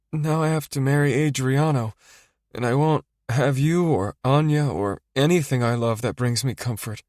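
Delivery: high, tearful voice; crying. Acted. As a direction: speak through tears. Nora crying to Rocco.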